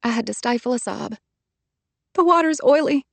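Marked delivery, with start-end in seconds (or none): 2.1-3.0 higher, tearful and strained; close to tears. A narrator's reading. Trapped woman telling Hardy she can't grip, tearful and high. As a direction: hold back a sob.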